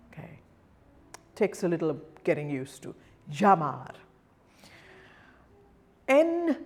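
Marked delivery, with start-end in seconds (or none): 3.3-3.9 Llamar opened on a J-Y blend; heavy double L. Shown as a demonstration. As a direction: lower pitched "zha-MAR" for Llamar. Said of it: "combination of a J and a Y".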